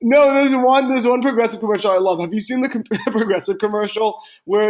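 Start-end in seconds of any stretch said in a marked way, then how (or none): none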